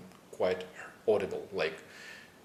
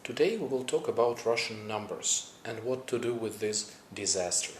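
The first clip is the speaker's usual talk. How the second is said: normal talking voice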